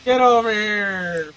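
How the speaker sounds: loud, slow "Get over here"; stretched, frustrated yell across the game; drawn-out shout. Real, not acted. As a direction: call it out long and loud. Calling out to teammate in game.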